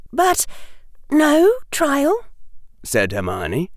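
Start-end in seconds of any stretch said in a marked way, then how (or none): 0.1-2.3 high, questioning schoolgirl; girl character voice. A narrator's reading. Hermione questioning Harry about the trial, high voice. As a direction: pitch it right up, querying.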